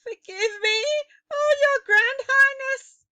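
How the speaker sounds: very high, loud desperate plea; cried. A narrator's reading. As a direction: wail for forgiveness. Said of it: "cried the miserable culprit".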